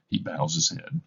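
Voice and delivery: ordinary talk, nothing marked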